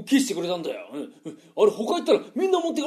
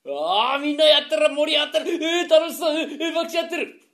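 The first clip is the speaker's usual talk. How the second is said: higher, sing-song excitement; excited exclaiming; 7 semitones higher than usual. Acted. Man delighted to find everyone gambling. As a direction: burst in delighted at the crowd.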